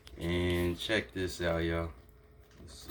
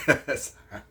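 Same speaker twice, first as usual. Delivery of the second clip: laughing, higher and louder; 4 semitones higher, 6 dB louder than usual. Real, not acted. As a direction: say it again through a laugh.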